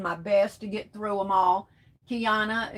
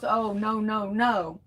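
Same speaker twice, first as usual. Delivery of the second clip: ordinary talk, nothing marked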